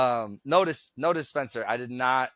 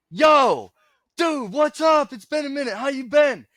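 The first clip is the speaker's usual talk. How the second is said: high, loud overeager greeting; excited stranger; 8 dB louder than usual. Retold or imitated. Stranger at the winery greets him like an old friend, high and loud. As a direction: play the stranger greeting an old buddy, higher and louder. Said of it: "he goes".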